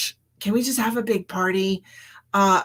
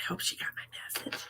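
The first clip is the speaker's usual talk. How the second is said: hushed private whisper; 14 dB quieter than usual. Real, not acted. Muttering an aside to herself, whispered. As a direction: breathe it, no voice.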